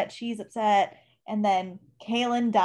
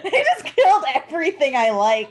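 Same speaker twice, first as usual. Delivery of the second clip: loud, high laughing protest; louder, raised-pitch playful grievance; mock outrage; 5 semitones higher, 7 dB louder than usual. Real, not acted. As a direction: wail it through laughter. Mock outrage over dead characters.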